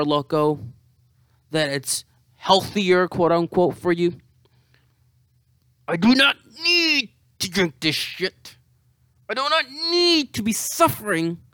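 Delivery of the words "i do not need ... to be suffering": high, loud whining lament; hungover whine. Real, not acted. Whining to the camera about drinking, much higher. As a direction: cry out in mock despair.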